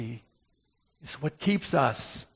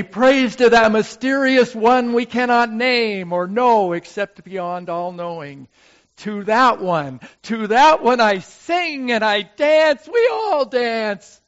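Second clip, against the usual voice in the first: ecstatic praise; 8 semitones higher, 8 dB louder than usual. Acted. Ecstatic praise, rising to a shout.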